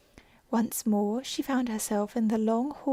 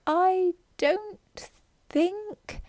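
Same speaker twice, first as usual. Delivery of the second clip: much higher, very slow and halting; confused; 9 semitones higher, much slower than usual. Acted. Confused Alice fumbling an answer, very slow and high. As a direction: trail off unsure and slow. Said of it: "very much confused".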